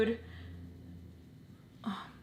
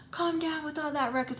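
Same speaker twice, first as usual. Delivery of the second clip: higher-pitched imitation of a scolding neighbor; lightly voiced scolding neighbor; neighbor lady; 7 semitones higher than usual. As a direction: mimic the lady calling down. Mimics upstairs neighbor lady.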